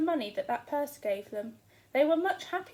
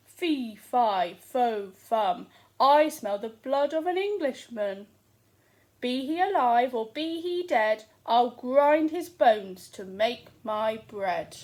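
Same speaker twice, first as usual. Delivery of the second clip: loud, slow giant's roar; booming giant; 8 dB louder than usual. Acted. The giant sniffing for Jack, booming loud. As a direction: boom it out like a hungry giant. Giant booms his chant.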